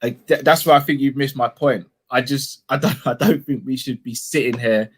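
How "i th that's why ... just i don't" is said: ordinary delivery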